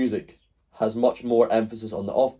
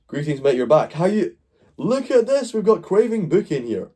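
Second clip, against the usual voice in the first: excited greeting; 9 semitones higher, 3 dB louder than usual. Real, not acted. Streamer greets returning viewer excitedly.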